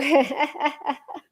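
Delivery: a short, happy laugh after the cheer; light laugh. Real, not acted. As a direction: laugh along with the winner. Cheering a listener who won.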